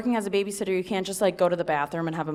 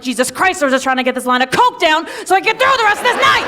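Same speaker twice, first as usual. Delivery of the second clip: exasperated snap; 10 semitones higher, 10 dB louder than usual. Retold or imitated. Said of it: "I'm like".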